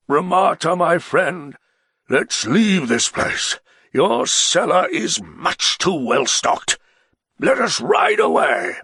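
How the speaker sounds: a tipsy nobleman begging to ride away; the Baron, pleading. Acted. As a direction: urge your friend to leave, loud and slow.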